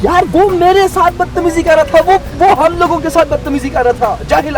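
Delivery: high-pitched, heated self-defence; worked-up, high-voiced complaint; indignant protest. Husband angrily defends himself in quarrel.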